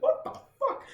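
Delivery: very high-pitched disbelief; acted panicked bewilderment. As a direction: mimic panic on a spinning bench. Clinging to spinning bench, retold.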